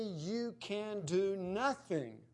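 "you can do nothing" slow and weighted word by word; emphatic. Retold or imitated. Emphatic reading of a key verse.

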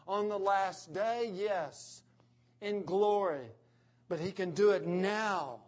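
rising preaching. Acted. Pastor preaching to the congregation with mounting force. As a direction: build the proclamation with force. Preacher builds about Jesus' power.

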